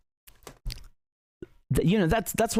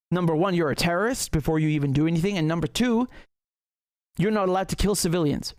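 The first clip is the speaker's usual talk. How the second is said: ordinary talk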